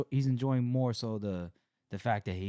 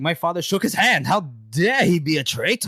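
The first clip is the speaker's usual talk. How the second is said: higher, louder mock outrage; indignant; 6 semitones higher, 8 dB louder than usual. Nobleman appalled at a family acquaintance's treason, indignant. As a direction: act scandalized, hammed-up. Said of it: "like, indignant".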